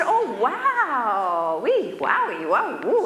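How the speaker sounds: lightly hammed-up wowing; mock amazement. Doctor teasing a patient over a cookie, mock amazement. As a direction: play it up as fake wonder. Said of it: "So he said".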